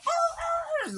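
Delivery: high-pitched mock dog yelp; imitated dog yell. Storyteller imitating the run-over dog, yelling. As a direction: yelp like a hurt dog, hammed up. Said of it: "he immediately starts yelling".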